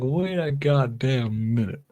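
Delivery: slow, high incredulous Aussie; Australian accent. Retold or imitated. Host imitating the man in the video, in a slow, high Australian accent. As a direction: stretch it out as a flabbergasted Australian. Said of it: "you can hear him just like".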